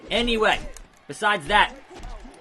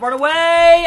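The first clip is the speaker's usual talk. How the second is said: very high, very loud scream; frantic yell to run away; panicked yell; 13 semitones higher, 13 dB louder, slower than usual. Real, not acted. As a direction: shriek to flee. Panic in game.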